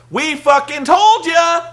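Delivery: loud, slow, drawn-out gloating yell; mock gloating shout. Heels shouting at the crowd on Impact, loud mock gloating. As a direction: shout it like a smug heel rubbing it in. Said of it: "be like".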